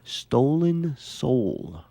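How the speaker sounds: slow, eerie reveal; spooky. Pretend. Telling a friend a spooky fact, slow and eerie. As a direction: drag it out like a ghost story.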